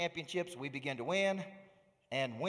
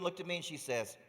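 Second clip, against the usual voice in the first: ordinary talk, nothing marked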